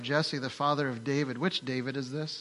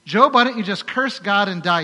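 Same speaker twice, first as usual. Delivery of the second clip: Job's wife's retort; 5 semitones higher, 10 dB louder than usual. Retold or imitated. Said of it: "you know what she says".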